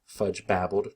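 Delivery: usual speaking voice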